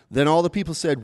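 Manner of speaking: usual speaking voice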